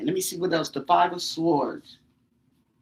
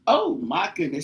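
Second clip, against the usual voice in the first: normal talking voice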